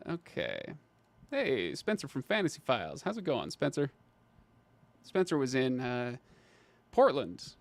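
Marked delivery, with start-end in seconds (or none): none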